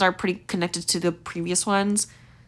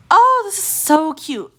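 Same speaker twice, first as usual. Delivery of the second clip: high, loud, drawn-out coo; delighted gushing; 10 semitones higher, 5 dB louder, slower than usual. Real, not acted. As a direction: gush slowly over something adorable. Delight on seeing next outfit.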